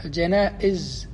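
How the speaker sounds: الجنائز with a short a after the jim; fatha on the jim. Shown as a demonstration. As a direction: say الجنائز as "al-jana'iz", hamza before the last syllable. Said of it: "تكون بالفتح الجيم فقط".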